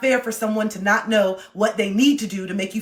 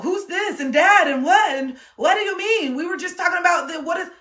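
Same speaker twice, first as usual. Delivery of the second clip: baffled partner; 9 semitones higher, 4 dB louder than usual. Retold or imitated. Playing the partner lost in the argument. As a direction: sputter in confusion.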